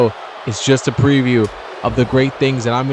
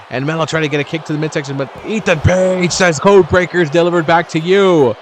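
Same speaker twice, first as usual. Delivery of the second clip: rapid build into a higher-pitched call; excited play-by-play; 4 semitones higher, 3 dB louder than usual. Real, not acted. Commentator calls code breakers delivered back, excited. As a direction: race in, then belt out the big move.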